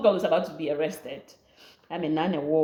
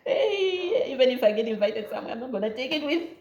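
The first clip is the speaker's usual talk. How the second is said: half-crying, half-laughing plea; desperate prayer, laughter cracking the voice; laughing mock prayer; 4 semitones higher than usual. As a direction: beg the Lord while laughing. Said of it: "I was there like".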